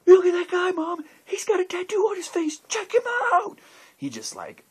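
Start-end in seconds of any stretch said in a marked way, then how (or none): 0.0-3.6 put-on much higher and louder, a thrilled little boy yelling; excited kid shout. Small boy in Walmart shouting to his mom about the face tattoo, thrilled and loud. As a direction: shout it like a kid who just spotted a wonder. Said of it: "like, so excited".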